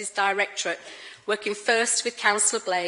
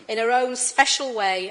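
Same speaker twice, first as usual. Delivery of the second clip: ordinary talk, nothing marked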